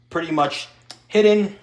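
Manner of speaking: ordinary talk, nothing marked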